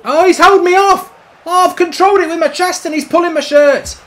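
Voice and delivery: outraged complaint. Real, not acted. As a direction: protest the foul loudly. Whining complaint about a foul.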